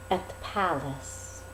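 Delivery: ordinary delivery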